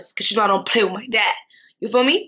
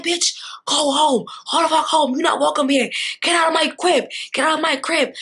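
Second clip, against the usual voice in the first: higher, heated command to leave; retold furious "get out"; furious order; 5 semitones higher than usual. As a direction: throw her out in a rage. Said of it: "So I'm like".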